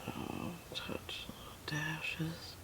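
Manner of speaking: soft unvoiced whisper while painting. Real, not acted. Painter whispering brush tips to her viewers, hushed whisper. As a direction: hush it right down, breathy whisper on every word. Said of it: "I don't know why I'm whispering".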